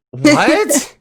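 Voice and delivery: high squeaky disbelieving what; baffled disbelief. Retold or imitated. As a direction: shoot it up high in shock. Half asleep, neighbor wants race.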